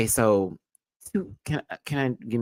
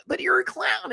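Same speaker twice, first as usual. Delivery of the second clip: high mocking squeal. Mocking quote.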